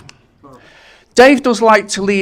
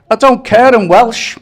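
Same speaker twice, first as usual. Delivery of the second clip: louder, higher defiant yell back; defiant shout; 6 semitones higher, 7 dB louder than usual. Retold or imitated. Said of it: "He shouts back".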